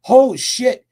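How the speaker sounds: sudden loud high exclamation; startled curse. Retold or imitated. Retelling interviewee's sudden realization, surprised loud blurt. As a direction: blurt it out as it dawns on you. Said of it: "he goes".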